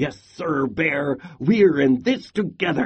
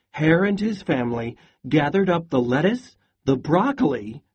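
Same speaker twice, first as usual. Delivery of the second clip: usual speaking voice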